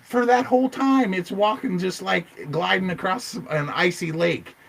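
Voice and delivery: high, loud, exasperated insistence; worked-up rant. Real, not acted. Believer defending the film's gliding walk, worked-up rant. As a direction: argue it with voice pitched right up. Ranting against the suit theory.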